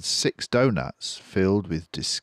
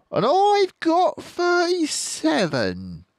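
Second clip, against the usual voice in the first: slow, high, louder topping boast; high boasting farmer; 6 dB louder, slower than usual. Acted. Bean topping Bunce, slow high boast. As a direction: top him slowly.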